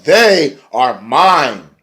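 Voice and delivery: slow, loud, emphatic declaration; hammed-up claim of ownership; imitated possessive diva. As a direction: stretch each word with mock grandeur. Imitates Maria claiming her team.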